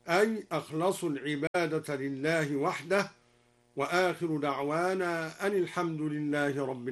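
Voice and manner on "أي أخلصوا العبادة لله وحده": ordinary talk, nothing marked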